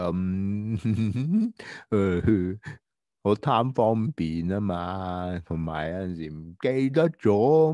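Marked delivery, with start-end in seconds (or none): 0.8-1.9 short sheepish giggle; embarrassed laugh. Acted. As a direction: laugh it off, caught out. B embarrassed about picking nose. (尴尬地笑一声)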